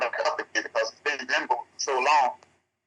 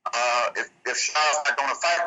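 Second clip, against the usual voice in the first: usual speaking voice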